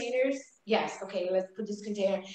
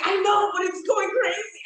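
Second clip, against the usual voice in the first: high, loud, frantic; panicked friend; 8 semitones higher, 4 dB louder than usual. Retold or imitated. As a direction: mimic the friend's alarm. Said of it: "She's like".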